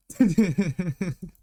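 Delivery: loud laugh. Real, not acted. Streamer cracking up at chat's guess, loud laugh. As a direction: burst out laughing at the comment.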